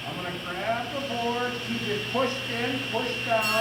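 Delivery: projecting over a running saw; shouting over table saw. Real, not acted.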